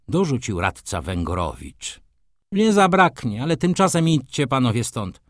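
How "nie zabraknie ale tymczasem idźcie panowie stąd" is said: raised, higher-pitched sharp order; shouted. A narrator's reading. Doctor ordering the men out, shouting. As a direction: cry out to clear the room. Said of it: "krzyknął".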